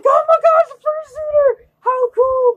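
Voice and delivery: high-pitched, loud fangirl excitement; excited gushing. Retold or imitated. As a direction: squeal it high and loud like a thrilled fan. Excited quote on meeting fursuiter.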